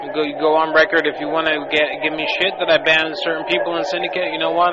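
ordinary talk

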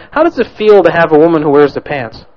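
ordinary talk, nothing marked

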